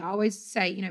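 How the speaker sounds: normal talking voice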